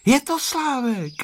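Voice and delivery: put-on high, drawn-out boyish cry; child's exclamation without real shouting; exclaiming. A narrator's reading. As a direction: cry out slowly in a very high boy's voice. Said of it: "vykřikl Honzík".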